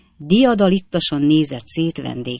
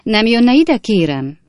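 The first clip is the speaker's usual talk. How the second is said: calling over; 6 semitones higher, 6 dB louder, slower than usual. A narrator's reading. Hostess calling editor over.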